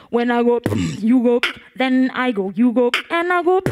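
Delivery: loud chant, rising to the crowd; call and response. Shown as a demonstration. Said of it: "for example".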